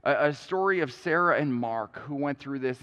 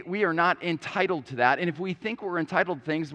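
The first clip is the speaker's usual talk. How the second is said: ordinary delivery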